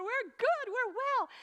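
high, loud jubilant shout; excited celebration. Acted. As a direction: shout it out, overjoyed for each other. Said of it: "They're so excited".

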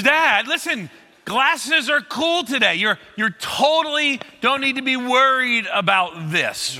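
put-on high, slow patient teen lecturing dad; teen daughter calming her dad; condescending teen. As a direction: talk down to your father kindly. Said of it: "that tone of voice that middle schoolers".

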